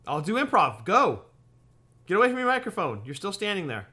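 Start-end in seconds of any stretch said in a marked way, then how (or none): 0.8-3.0 loud urging. Real, not acted. Urging co-host to leave mic.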